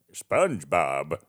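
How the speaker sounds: attempted cartoon villain voice, drawn out; Plankton. Shown as a demonstration. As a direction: stretch the name out like a scheming cartoon. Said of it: "try to do a Plankton voice".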